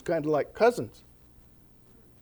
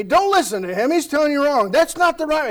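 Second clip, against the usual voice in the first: mock yell; 8 semitones higher, 6 dB louder than usual. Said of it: "starts yelling at this guy".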